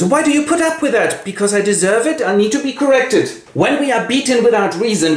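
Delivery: put-on high girls' voices. Girls talk at school.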